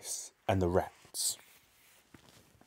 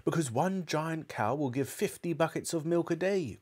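high, eager explanation; excited cry; 7 semitones higher than usual. A narrator's reading. Father waving his arms as he explains to his son, excited. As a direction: lift the pitch, marvelling. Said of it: "cried Mister Cranky".

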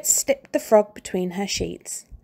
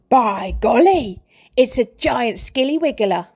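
Mr Twit mock alarm; 3 semitones higher, 5 dB louder than usual. A narrator's reading.

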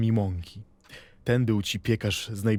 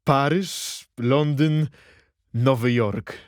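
louder, proclaiming each city like a great capital; grand announcement; 4 semitones higher, 5 dB louder, slower than usual. Retold or imitated. Bałdowski reading village signs like world capitals, loud proclamation. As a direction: announce the names as if to a crowd. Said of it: "jakby oznajmiał".

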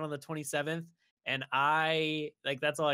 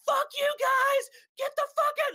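furious yelling; 6 dB louder than usual. Retold or imitated. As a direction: scream at the other racers like a psycho. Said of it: "she's just so mad".

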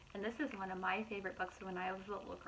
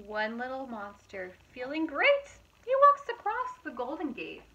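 lively, louder storybook narration; reading to children; 3 semitones higher, 10 dB louder than usual. A narrator's reading. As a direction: read it out brightly to a young audience. Reading picture book aloud.

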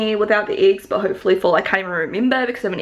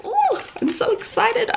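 much higher, slow, gushing delight; excited; 13 semitones higher than usual. Real, not acted. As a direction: squeal it with pure joy. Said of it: "gets this excited".

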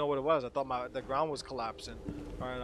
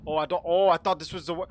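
loud, flustered outburst; alarmed and cut short; mounting panic; 9 dB louder than usual. Real, not acted. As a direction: blurt it out as time runs out. Running out of breath underwater.

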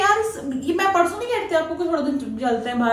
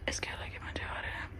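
gleeful hushed whisper aside; 17 dB quieter than usual. Real, not acted. Vlogger whispering to viewers mid-prank. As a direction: whisper the fun part to the viewers.

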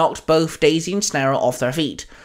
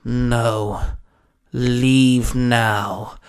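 low, grunted refusal; 4 semitones lower, much slower than usual. A narrator's reading. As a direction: grunt it out in a lowered voice. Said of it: "Hex grunted".

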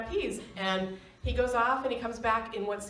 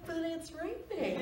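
higher, softer, slow mock whine; whiny protest; 9 semitones higher, 5 dB quieter, slower than usual. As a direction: pout at the idea of rain. She protests the rain.

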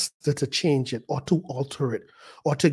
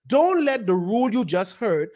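ordinary delivery

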